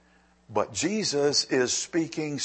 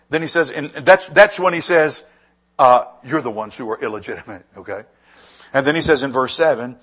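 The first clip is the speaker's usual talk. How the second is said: loud preaching build; 12 dB louder than usual. Acted. Preacher drives the verse home, loud then settling. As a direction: press it home to the congregation.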